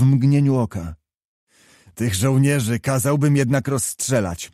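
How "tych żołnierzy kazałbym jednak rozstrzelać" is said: usual speaking voice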